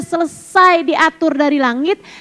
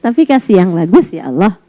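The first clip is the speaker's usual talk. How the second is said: ordinary talk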